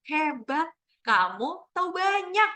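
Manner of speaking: slow, high storybook praise; slowed at comma. Shown as a demonstration. Teacher rereading "Hebat" with its comma, slow and lifted. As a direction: draw out HEBAT, pause, then carry on high. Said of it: "kita bisa lambatin".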